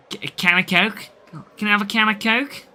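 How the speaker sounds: amateur attempt at a Liverpool Scouse accent; bad Scouse accent. Streamer trying out an accent for chat, bad Scouse. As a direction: have a clumsy go at Scouse. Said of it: "it's the worst Scouse accent you'll ever hear".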